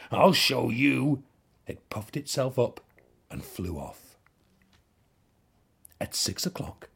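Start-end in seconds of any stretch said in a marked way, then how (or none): 0.1-1.2 huffy. A narrator's reading. Ladybird huffs off.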